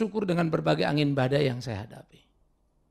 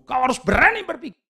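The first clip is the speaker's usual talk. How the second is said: forceful command; 6 semitones higher, 4 dB louder than usual. Acted. Preacher voicing Paul's command.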